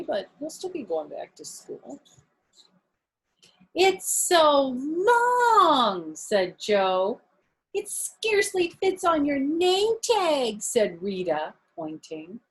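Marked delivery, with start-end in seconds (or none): 3.7-6.1 slow, high, drawn-out teasing; mocking child. Classmate Joe teasing about her long name, slow and high. 7.7-10.6 high teasing voice; teasing child. Acted. Rita teasing about the name tag, high voice. Classmate teases her.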